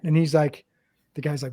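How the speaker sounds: ordinary delivery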